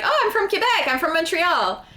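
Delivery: a lightly voiced eager reply, jumping high on "Oh"; proud, eager answer. Telling the French strangers where she's from, in a lightly excited voice. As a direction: announce it brightly, like good news. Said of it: "And I'm like".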